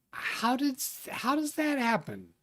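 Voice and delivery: high-pitched, puzzled question; baffled. Real, not acted. Baffled at a viewer holding first place.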